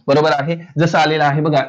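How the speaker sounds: normal talking voice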